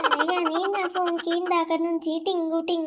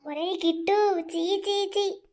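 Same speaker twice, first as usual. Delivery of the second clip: disgusted cry; 3 semitones higher, slower than usual. Acted. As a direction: recoil in disgust at the photo. Mother sees son's morphed photo.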